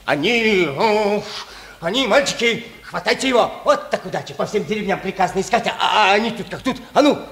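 gloating excitement. Acted. Village elder finds the wanted children, shouting in triumph. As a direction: crow over the catch and shout commands.

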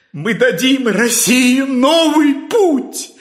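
slow, building to a high ecstatic cry; rising fervor. Acted. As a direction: preach it to a fever pitch. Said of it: "Профессор вошёл в выступление".

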